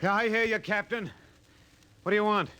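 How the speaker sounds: high, carrying reply down the slope; calling back. Acted. Spender calling down to the captain, shouting. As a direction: shout down to him, guarded.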